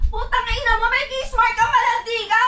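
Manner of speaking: put-on furious shouted insult at the teenager; angry yelling. Older sister screaming at her teenage ward over a hickey, furious shout. Sister scolding caught teen.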